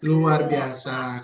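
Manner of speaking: slow, low, drawn-out wonder; drawn-out praise. Acted. Teacher praising a child's answer, slow amazed drawl. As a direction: stretch it out like a delighted teacher.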